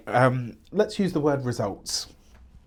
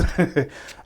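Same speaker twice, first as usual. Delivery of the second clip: breathy laugh; 3 semitones higher than usual. Real, not acted. Laughs at own hiccups.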